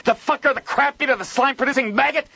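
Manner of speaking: high, loud slurred cursing; furious fast ranting. A narrator's reading. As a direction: spit out insults in rage. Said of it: "Joel spoke so fast that his words slurred".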